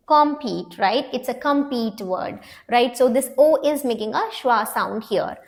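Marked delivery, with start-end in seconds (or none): none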